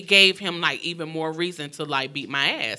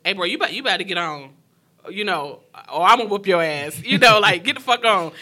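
fast, louder street warning; retold threat; 2 semitones higher, 7 dB louder, faster than usual. Brother threatening the abuser to leave, loud and fast. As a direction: run him off with a quick, loud threat.

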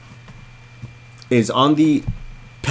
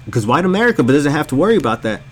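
ordinary delivery

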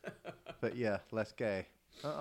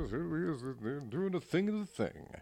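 bland copy read, slightly higher and louder; reading the copy like a stiff pro; mock announcer read; 6 semitones higher, 4 dB louder than usual. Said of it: "they're going through".

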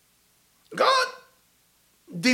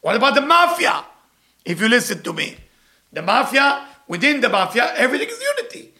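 usual speaking voice

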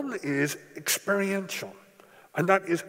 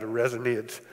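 ordinary talk, nothing marked

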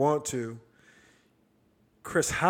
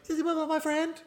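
much higher, squeaky little-dog voice; yippy little dog. Retold or imitated. Host casting himself as the yippy dog, high and squeaky. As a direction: yap it like the tiny cartoon dog. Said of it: "you got the little yippy dog going".

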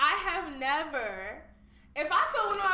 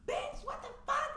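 high, slow mock outrage; mock outraged yell; 11 semitones higher, slower than usual. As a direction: squeal it out like she's shocked. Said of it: "So she was like".

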